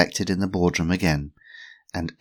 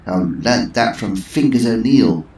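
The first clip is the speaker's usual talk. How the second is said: put-on slow, louder proud old lady; Missus Twistleton; 4 semitones higher, 9 dB louder than usual. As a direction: savour the name. Twistleton voiced.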